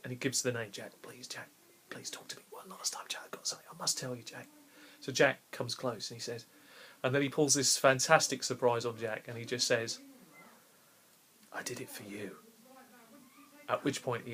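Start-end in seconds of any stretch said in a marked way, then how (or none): 0.7-4.5 barely alive, whispering, much softer; dying whisper. Retold or imitated. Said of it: "he's barely alive, and he begins to try and talk". 11.5-12.4 low, much softer, fading last words; dying last words. Retold or imitated. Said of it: "he's barely alive".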